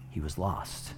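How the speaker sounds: whispered scripture, lost and found. Real, not acted. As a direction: breathe the ending out. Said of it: "scripture climax whispered".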